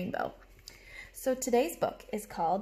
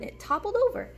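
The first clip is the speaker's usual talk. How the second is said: much higher and louder on the tumble; dramatic story build; 10 semitones higher, 6 dB louder than usual. Acted. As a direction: make the kids gasp as it topples.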